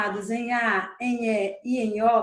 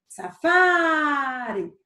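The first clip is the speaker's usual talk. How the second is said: "safari" with a very long "fa"; stretched stressed syllable; much slower than usual. Shown as a demonstration. Teacher showing a paroxítona, "fa" of "safari" stretched long. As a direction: hold the "fa" in "safari", then drop on "ri". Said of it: "Onde foi que eu passei mais tempo chamando".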